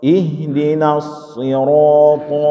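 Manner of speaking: slow "Ihdinas sirata" with a heavy, thick shad. Shown as a demonstration. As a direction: draw out "sirata" with a full shad. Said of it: "lebih tebal lagi, sama dengan shad". ("Ihdinas sirata" pelan dengan shad tebal)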